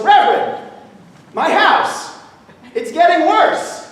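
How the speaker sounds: high, loud comic distress; desperate complaint. Acted. Farmer back at the Reverend, hammed-up desperation. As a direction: cry out for help, hammed up.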